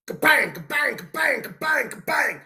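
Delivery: high-pitched rhythmic "kabang" clanging; hammering noise. Retold or imitated. Mimicking the coworker wailing on the vise, clanging noise. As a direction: imitate hard hammer blows on metal. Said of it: "he's wailing on the vise".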